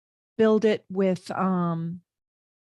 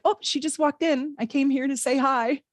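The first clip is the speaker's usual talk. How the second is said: higher, lightly voiced excited friend; mock a chirpy family friend who just popped in; 7 semitones higher than usual. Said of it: "And then he's like".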